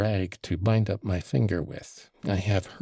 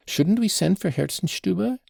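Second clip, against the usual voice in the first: higher, anxious call for the doctor; anxious cry; 12 semitones higher, 4 dB louder than usual. Acted. Mother suggests sending for the doctor, anxious cry. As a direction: cry out in a flutter. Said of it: "cried Madame Hohlakoff".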